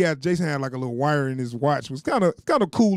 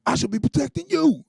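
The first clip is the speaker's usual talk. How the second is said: high, mocking sing-song quote; teasing a teen girl's overblown vow in a high voice; mock protective girl; 7 semitones higher than usual. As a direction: mimic her sappy line to poke fun at it. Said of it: "She like".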